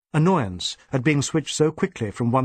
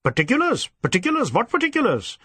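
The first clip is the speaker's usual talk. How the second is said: blustering surprise; 10 semitones higher, faster than usual. Acted. DSP puzzled bluster.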